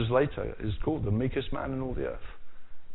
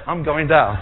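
louder punchline; mock threat; 9 dB louder than usual. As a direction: deliver the lecturer's joke with a loud punch. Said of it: "And he said".

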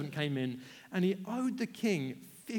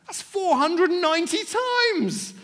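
high, loud, slow astonished outcry; shocked realisation; 12 semitones higher, 12 dB louder, slower than usual. Acted. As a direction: gasp out the huge number in disbelief. Peter shocked doing the math.

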